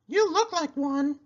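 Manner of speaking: a llama giving friendly advice; Cherry Sue voice. A narrator's reading. Llama advising her friend the pig, high and sweet. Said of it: "said Cherry Sue".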